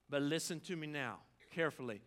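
normal talking voice

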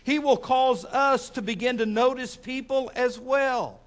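loud, deliberate preaching with drawn-out phrases; emphatic preaching. Real, not acted. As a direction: press each phrase on the congregation. Preaches to congregation.

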